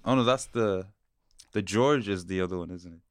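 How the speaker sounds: ordinary talk, nothing marked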